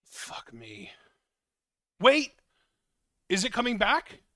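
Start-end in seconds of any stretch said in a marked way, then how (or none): 0.0-0.9 under-the-breath whisper, much softer; softly whispered frustration; real whispered curse. Real, not acted. Computer crashing live on air. 2.0-2.3 much higher, louder cry of alarm; real startled yell; sudden shout. Real, not acted. Call dropping suddenly.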